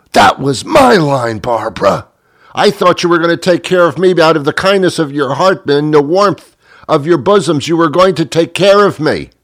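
high-pitched wounded mock protest; betrayed outrage. Diner accusing waitress Barbara of stealing his line, betrayed outrage. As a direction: wail your jealousy theatrically.

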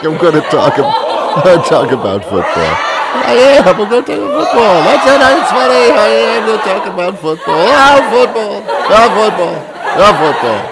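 high, loud giggling through words; can't stop laughing; laughing fit. Real, not acted. Host cracking up at himself, giggling high and loud.

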